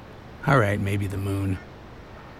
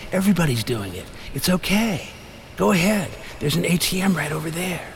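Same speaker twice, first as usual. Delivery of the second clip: sly tempter's voice climbing higher; devilish whisper; 5 semitones higher than usual. A narrator's reading. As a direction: coax them like the devil in their head. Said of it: "in a kind of fiendish, devilish whisper".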